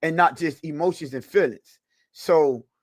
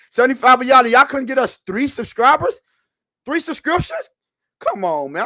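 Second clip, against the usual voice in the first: loud, high disbelieving scold; exasperated; 8 semitones higher, 6 dB louder than usual. Real, not acted. Host scolding his chat for not subscribing, loud exasperated disbelief. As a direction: call out the chat like you can't believe it.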